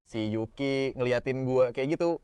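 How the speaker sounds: ordinary delivery, nothing marked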